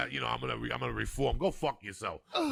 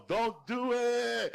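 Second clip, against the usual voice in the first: higher, louder, slow drawn-out laughing yell; retold yell; 10 semitones higher, 6 dB louder, much slower than usual. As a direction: shout it like the crowd did, laughing. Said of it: "all the Yams would yell".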